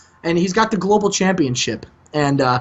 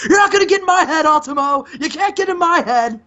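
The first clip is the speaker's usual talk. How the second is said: higher-pitched mock taunt, half-yelled; 8 semitones higher, faster than usual. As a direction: insist he can't be rattled, pitched up. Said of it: "and he's like".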